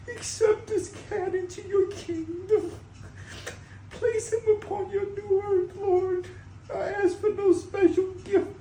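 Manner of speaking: slow, sobbing prayer, very high; tearful prayer. Real, not acted. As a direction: pray through tears to Jesus. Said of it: "I'm saying".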